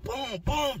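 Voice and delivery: tired mimic of kicks; door kicks. Inmate with sore foot imitating door kicks.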